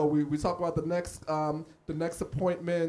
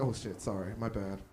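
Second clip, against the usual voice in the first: low, quiet, embarrassed sorry; sheepish apology; 11 semitones lower, 9 dB quieter than usual. Retold or imitated. Comic to his doctor, low and sheepish. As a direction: back off, caught out. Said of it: "I was like".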